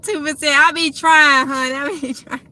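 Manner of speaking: amused, breaking into a laugh; laughing reply. Real, not acted. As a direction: protest playfully through laughter. Laughing reply to viewer's tease.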